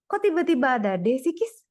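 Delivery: high, loud, quick challenge; baffled protest. Real, not acted. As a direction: burst out in confused disbelief. Baffled challenge to study partner.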